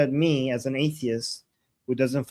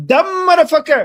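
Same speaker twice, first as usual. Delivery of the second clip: loud high shout; shouted curse; 12 semitones higher, 12 dB louder, faster than usual. Acted. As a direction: yell it out. Shouted outburst.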